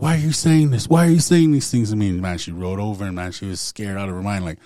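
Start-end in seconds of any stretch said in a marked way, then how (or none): 0.0-2.1 higher, louder frightened plea; terrified husband confronting his wife; panicked pleading. Retold or imitated. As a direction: shake her and demand an answer in fear.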